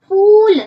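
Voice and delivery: slow पूल with a stretched ऊ; teacher stretching the vowel for the class; long oo demo. Shown as a demonstration. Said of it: "long ऊ की आ रही है".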